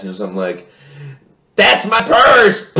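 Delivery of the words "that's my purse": high, loud, drawn-out cartoon yell; retold Bobby yell. Bobby yelling his class line as he kicks the bully, mock yell. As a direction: yell it like a self-defense battle cry. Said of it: "he yells out something he learned".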